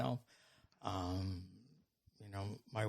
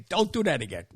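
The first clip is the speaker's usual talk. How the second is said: mock-stern reprimand, higher and louder; raised-voice telling-off, played for laughs; retold scolding; 5 semitones higher, 9 dB louder than usual. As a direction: scold like an exasperated teacher. Retelling staff scolding a prankster.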